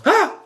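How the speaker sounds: short, high, loud "Ah!"; sudden little scream of pretend fright; mock scared yelp. Reviewer admitting a fear of fire, mock scream.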